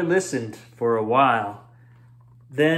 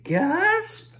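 sharp high gasp. A narrator's reading. Narrator gasping at the shrinking patch.

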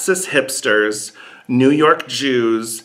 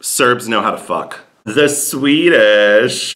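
normal talking voice

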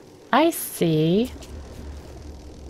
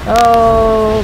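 loud startled exclamation; loud alarmed oh; 2 semitones higher, 10 dB louder than usual. Real, not acted. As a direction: blurt it out in shock. Water bursting in.

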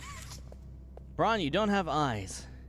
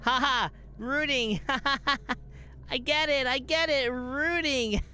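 high-pitched, giggly amusement; delighted laughing; 7 semitones higher than usual. Real, not acted. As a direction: crack up between the words as the joke lands. Gamer cracks up at a pun.

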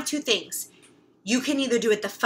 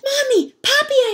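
high, loud call for mom and dad; a child's excited shout across the yard; 6 dB louder than usual. Acted. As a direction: call out to the parents with all you've got. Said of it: "I shout".

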